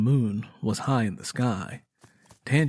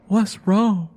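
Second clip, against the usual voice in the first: much higher, louder and urgent; anxious questions rushed out; worried; 8 semitones higher, 6 dB louder than usual. A narrator's reading. Lenny asking breathless Tangy what's wrong, much higher and worried.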